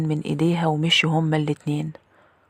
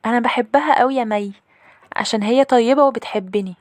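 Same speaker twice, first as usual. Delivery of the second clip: put-on high-pitched little girl voice; little sister; 7 semitones higher, 4 dB louder than usual. Little sister tells her older sister she loves the maid, high little-girl voice. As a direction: play an eager little sister, pitched well up. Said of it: "أختي الصغيرة ... ردت عليا وقالت لي".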